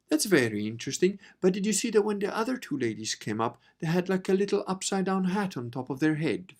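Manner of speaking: a hint of a polite English gent; posh English husband. Husband at the talk chiming in, mildly posh English. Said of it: "stand up and goes".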